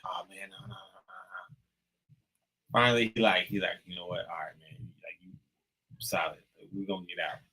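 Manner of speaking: low, hushed pleading under the breath; hushed pleading friend. Retold or imitated. Friend quietly begging the narrator not to run, hushed. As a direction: keep it down so the cop can't hear.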